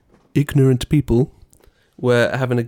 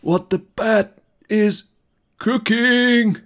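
high, drawn-out wrestler catchphrase; The Rock impression; 9 semitones higher, 4 dB louder, slower than usual. Co-host teasing with a wrestler-as-Batman catchphrase, slow high hammed-up showman voice. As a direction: stretch out each word like a showman's taunt.

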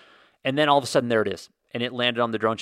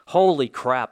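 loud burst of excitement; excited exclaim; 3 semitones higher, 8 dB louder, slower than usual. Real, not acted. Vlogger opening the SpaceX point to viewers, loud excited blurt. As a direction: blurt it out, thrilled.

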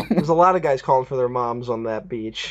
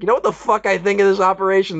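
mock rant; 7 semitones higher, 4 dB louder, faster than usual. Imagined Maron outburst.

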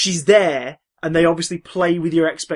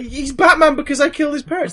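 high, loud, near-tearful wail; a crook in hysterics at his own crime; panicked confession; 11 semitones higher, 7 dB louder than usual. Retold or imitated. Mugger panics, retold cartoon scene.

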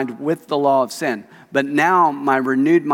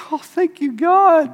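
relieved thanks; 13 semitones higher, 4 dB louder than usual. Retold or imitated. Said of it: "I just went".

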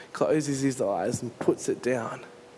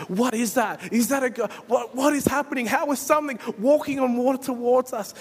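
high, rising frantic alarm, loud; panicked shout from the boat; panicked disciples; 10 semitones higher, 4 dB louder than usual. Acted. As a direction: cry out in terror at a ghost on the water. Disciples panic at figure on water.

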